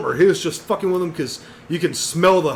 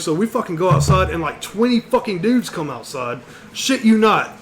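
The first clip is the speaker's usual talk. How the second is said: normal talking voice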